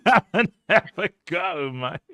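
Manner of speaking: loud, high mock-Cockney; imitated Cockney accent. Host mimics the guest's taunt, laughing mock Cockney. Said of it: "Cockney accent".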